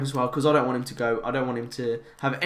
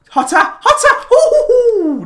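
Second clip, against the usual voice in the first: excited shout; 10 dB louder, much slower than usual. Real, not acted. Shouting at a chance.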